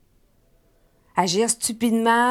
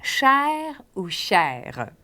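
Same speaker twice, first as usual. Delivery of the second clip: Cher ou chère said very slowly; slow word intro; much slower than usual. Shown as a demonstration. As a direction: draw out Cher ou chère, syllable by syllable. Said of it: "présente le mot lentement". (mot présenté très lentement)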